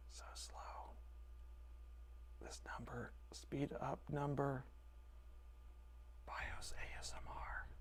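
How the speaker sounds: hushed whisper. Real, not acted. Streamer whispering to chat during slow progress. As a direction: breathe the words, no voice.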